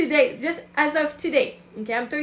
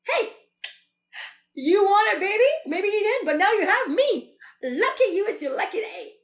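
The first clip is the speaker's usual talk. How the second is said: put-on higher, cheeky self-announcing baby; different, bolder energy; 5 semitones higher than usual. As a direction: present yourself as their lucky prize. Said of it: "that's a different energy than saying".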